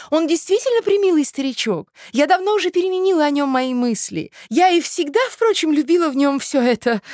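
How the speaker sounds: very high, loud, gushing farewell; effusive society lady. Acted. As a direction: sing out grand goodbyes to a dear friend.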